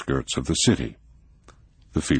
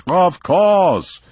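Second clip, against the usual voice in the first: higher, louder, grandly generous; expansive; 7 dB louder than usual. A narrator's reading. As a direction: wave away all doubt. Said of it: "said von Eagelfeld expansively".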